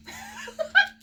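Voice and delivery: a high, teary burst of laughter. Real, not acted. Laughing at the dog.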